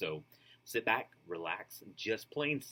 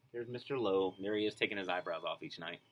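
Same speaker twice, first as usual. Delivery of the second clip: usual speaking voice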